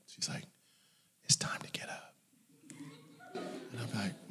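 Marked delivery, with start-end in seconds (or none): 1.2-2.1 whispered motherly wake-up call; hushed, coaxing mom voice; gentle mother. Retold or imitated. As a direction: whisper it softly to a sleeper. Gentle mother waking child.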